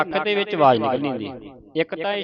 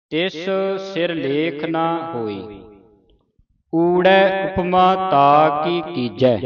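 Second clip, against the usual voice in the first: slow, drawn-out measured chant; slow scripture recitation; 3 semitones higher, much slower than usual. Shown as a demonstration. Teacher reciting scripture slowly for a student. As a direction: recite slowly, pausing at each stop.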